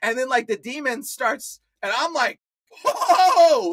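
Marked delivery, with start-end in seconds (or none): 2.8-3.7 high, loud startled whoa; sudden loud cry of alarm; startled high yelp. Retold or imitated. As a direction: jump back in shock. Startled reaction to the demon.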